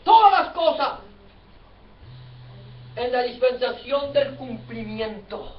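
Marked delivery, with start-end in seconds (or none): 0.0-1.0 "Todas" punched high and loud, falling away after; loud, high stress on "Todas"; emphatic repetition. Real, not acted. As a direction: hit "Todas" hard, then let the pitch fall. Preacher stresses the repeated phrase.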